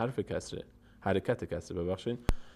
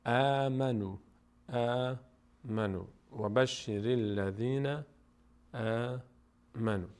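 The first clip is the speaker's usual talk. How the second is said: Arabic syllables stretched very long; slow model recitation; much slower than usual. Shown as a demonstration. Teacher reading with the silent alef, slow drawn-out recitation. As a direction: recite slowly, lingering on each long vowel. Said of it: "این خونده نمیشه".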